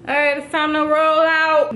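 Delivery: pumped-up rally to go; excited. Real, not acted. Hyped leaving for photo shoot.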